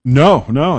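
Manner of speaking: normal talking voice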